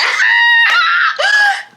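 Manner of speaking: high loud burst of laughter; shrieking laugh. Real, not acted. Cracking up at her own joke with a loud shrieking laugh.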